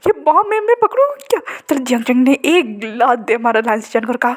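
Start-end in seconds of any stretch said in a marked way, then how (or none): 0.1-1.4 incredulous joke. Acted. Mocking reply about a bomb.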